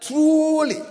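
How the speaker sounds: truly said high and apart; single out the word truly; stress on truly. Shown as a demonstration. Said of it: "emphasis on the word truly".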